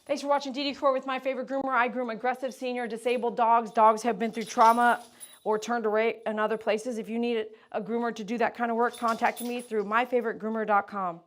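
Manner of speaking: brisk outro read, fast at the start; promo outro read. Acted. Groomer wrapping up the video for viewers with a brisk promo read. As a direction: pitch the grooming business briskly.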